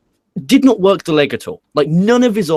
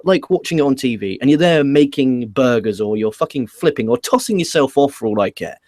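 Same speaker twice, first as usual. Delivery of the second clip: normal talking voice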